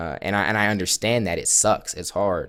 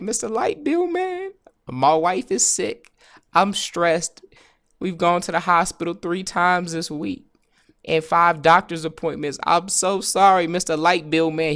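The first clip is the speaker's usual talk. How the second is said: very high, mock-pitiful plea; hammed-up begging, high and drawn out; pleading debtor; 9 semitones higher than usual. Stressed customer excusing himself to Mister Light Bill Man, high mock plea.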